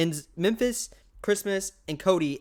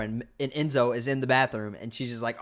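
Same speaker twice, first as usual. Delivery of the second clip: ordinary talk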